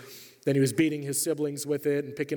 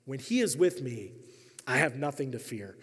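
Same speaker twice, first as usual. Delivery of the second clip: normal talking voice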